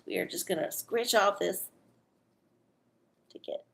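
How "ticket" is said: ordinary talk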